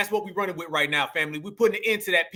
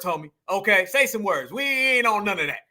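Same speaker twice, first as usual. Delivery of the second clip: ordinary talk, nothing marked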